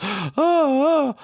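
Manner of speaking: high gasping oh oh, hammed up; loud mock-panic gasps; panicked gasps. Overwhelmed by orders.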